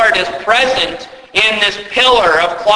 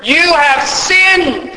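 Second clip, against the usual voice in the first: much higher, slow and accusing; mock accusation; 9 semitones higher, much slower than usual. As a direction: pronounce it like an indignant accuser. Voicing accusation against God.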